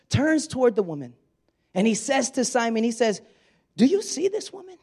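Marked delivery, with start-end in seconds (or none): 3.8-4.8 high, sharp question with weight on you; pointed question. Shown as a demonstration. Jesus challenging Simon, high and pointed. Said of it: "I wonder if that was literal".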